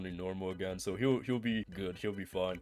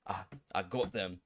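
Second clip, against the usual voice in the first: badly faked Butcher accent, jumping high and loud at the end; 5 semitones higher, 6 dB louder than usual. Butcher reveals to Huey he faked his death, bad accent. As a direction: attempt a hammy Butcher accent. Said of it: "I'm so bad at these, bro".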